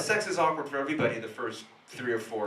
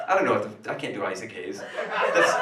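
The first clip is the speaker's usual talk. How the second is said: ordinary delivery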